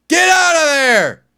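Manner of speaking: high shouted order; yelling get out. Acted. Ranting comic yelling at a scared clerk, shouted order. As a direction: bark him out of the store.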